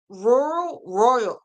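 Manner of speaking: "rural" stumbling into "royal", the r sounds tangled; tongue-tied attempt. Real, not acted. Crafter attempting a word they can't say, tongue-tied. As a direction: trip over the r's in "rural, royal". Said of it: "word I definitely can't say".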